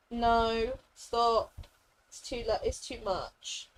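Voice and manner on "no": ordinary delivery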